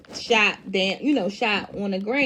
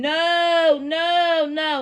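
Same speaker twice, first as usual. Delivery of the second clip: mild mock scream, drawn-out higher no's; retold scream; 6 semitones higher, much slower than usual. Tasha runs up as he's shooting Ghost, retold scream. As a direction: imitate her screaming without really screaming. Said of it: "Tasha runs up screaming".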